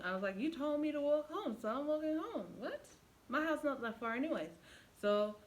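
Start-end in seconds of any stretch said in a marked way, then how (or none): 0.4-4.5 higher, a bit louder sassy comeback; sassy retort. Retold or imitated. Walking away, she sasses her boyfriend back. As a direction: shrug him off with sass.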